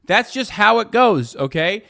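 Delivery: loud, high yelling at chat. Real, not acted. Streamer yelling at chatters about guns and safety, loud. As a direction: let it rip, heated.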